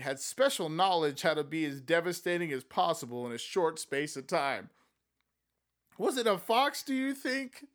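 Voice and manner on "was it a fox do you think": Amanda voice. Acted.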